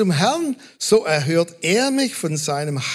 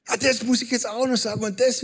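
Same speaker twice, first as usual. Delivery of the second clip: hurried; 4 semitones higher, faster than usual. Retold or imitated. Imitating woman's eager retelling.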